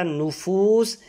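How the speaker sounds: نفوس said slowly with a rounded u; slow careful pronunciation. Shown as a demonstration. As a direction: sound نفوس out slowly, syllable by syllable. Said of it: "نون پر پیش".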